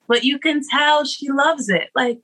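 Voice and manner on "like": ordinary talk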